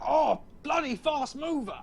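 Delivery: high, drawn-out British character voice; Saw impression. Shown as a demonstration. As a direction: ham it up as a British game hero, slowly. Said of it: "the sound of Saw's voice".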